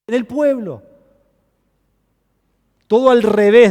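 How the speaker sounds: proclama alto, con fuerza de púlpito; predicación enérgica. Acted. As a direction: predica con fervor a la congregación. Preaching at full force. (predicador en pleno fervor ante la iglesia, voz fuerte)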